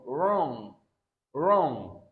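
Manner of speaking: "Wrong" ending on ng, no hard g; "Wrong" fading on the nasal; silent g. Shown as a demonstration. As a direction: repeat "Wrong" as the correct model. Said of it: "Not wrong".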